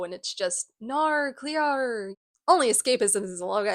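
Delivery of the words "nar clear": imitating Australian TV speech, higher; mock Australian vowels from a show; Australian accent. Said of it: "My sisters have been rewatching H two O".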